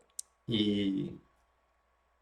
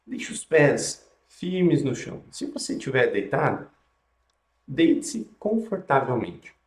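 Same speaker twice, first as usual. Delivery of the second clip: guided meditation; 3 dB quieter than usual. Acted.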